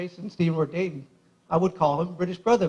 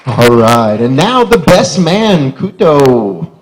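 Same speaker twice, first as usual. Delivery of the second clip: loud, drawn-out emcee call into the mic; booming slow introduction, lower pitch; MC announcement; 5 semitones lower, 15 dB louder, much slower than usual. Real, not acted. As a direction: announce the best man like a host. MC announcing the next speaker.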